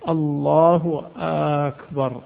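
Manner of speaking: الله drawn out very slowly; slow questioning stretch of الله; stretched hamza. Shown as a demonstration. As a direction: drag the opening of الله then أكبر. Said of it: "همزة الله أو همزة أكبر".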